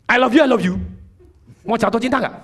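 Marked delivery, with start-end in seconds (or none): 0.1-0.8 brusque, loud, hurried love declaration; wrong tone. Shown as a demonstration. Trainer showing how tone spoils "I love you", brusque and loud. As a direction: throw "I love you" out hard and loud, without tenderness. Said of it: "Anda ngomongnya gini".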